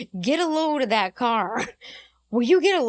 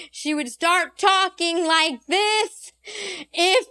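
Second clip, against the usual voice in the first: slow, louder, over-spelled words, mocking granny; slow, loud grandma talk; 4 semitones higher, 3 dB louder, much slower than usual. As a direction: drag every word out loudly like a granny to a foreigner. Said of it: "my grandmother used to do this".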